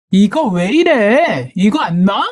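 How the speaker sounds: mild acted yell, slow and drawn out; angry protest. Sister-in-law grabbed by the hair, mildly yelled protest. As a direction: drag out an indignant protest. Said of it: "소리 지르더군요".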